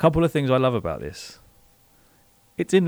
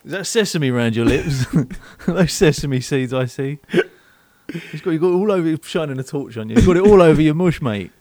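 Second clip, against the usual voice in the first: bouncer; 4 semitones higher, 7 dB louder than usual. Pretend. Bouncer inspecting customer.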